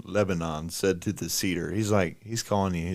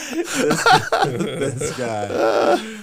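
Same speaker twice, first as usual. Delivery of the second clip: words squeezed out through laughter; can barely get it out for laughing; 10 semitones higher, 7 dB louder, much slower than usual. Real, not acted. Cohost cracking up at the king's bragging, laughing.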